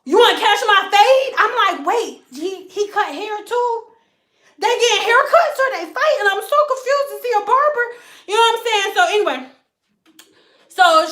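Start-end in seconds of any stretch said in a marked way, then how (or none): 0.0-1.3 higher, louder street challenge; mock tough challenge. Californians inviting a fight, mock tough challenge. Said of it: "imitating Californians". 1.9-8.9 higher, baffled questioning; confused. Retold or imitated. Stylist baffled by the slang, higher and confused.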